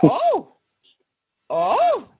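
high, drawn-out mock gasp; a hammed-up shocked oh; mock surprised oh. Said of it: "I be like".

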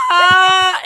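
high, loud blaring wail like a truck horn; imitated movie cry. Mimicking the film cry for laughs, loud mock wail. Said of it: "It sounded like the truck horn".

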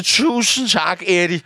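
slow, stretched-out mock gratitude; drawn-out thanks. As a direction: draw the thanks out slowly. Retells cocky greeting to mistaken man. (langtrukken, spøgefuld tak)

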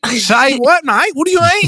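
put-on high, agitated Australian accent; indignant Australian. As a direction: demand an answer like an exasperated Aussie. Australians bickering over meaning.

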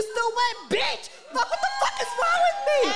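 high squealing laugh through words. Real, not acted. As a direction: laugh it out at yourself. Laughing at herself.

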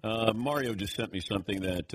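ordinary talk, nothing marked

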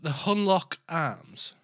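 spelled out slowly while writing; slow, deliberate. Real, not acted. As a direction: say it slowly to note it down. Repeating name while writing.

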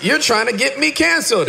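high hammed-up rebuking of Satan; mock rebuke. Preacher jokingly rebuking God's answer as Satan. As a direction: rebuke the devil in mock horror. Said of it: "And I was like".

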